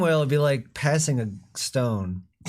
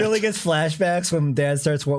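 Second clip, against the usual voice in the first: ordinary talk, nothing marked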